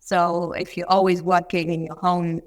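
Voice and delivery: normal talking voice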